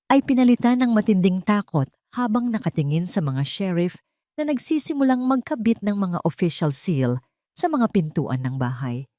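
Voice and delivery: ordinary delivery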